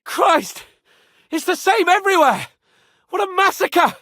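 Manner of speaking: loud, high horrified cry; shocked shout. Real, not acted. Seeing the massacre, shouting in horror.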